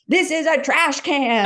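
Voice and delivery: high, slow mock-indignant dad, with a laugh. Dad correcting his daughter's Australian word, mock indignant and slow. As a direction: play the stubborn American dad, slow and put out. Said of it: "stomped his foot and was like".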